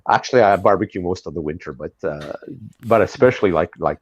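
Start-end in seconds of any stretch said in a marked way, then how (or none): none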